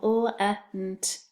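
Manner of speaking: "l-e-n-t" one sound at a time; second syllable sounded out. Shown as a demonstration. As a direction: tap out each sound in "l-e-n-t". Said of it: "second syllable".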